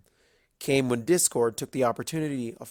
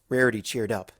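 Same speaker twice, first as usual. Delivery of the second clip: usual speaking voice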